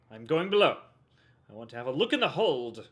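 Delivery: a hint of an old-timey register; lightly voiced stiff old-timey read; Tenya voice. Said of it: "why does your voice always become Tenya".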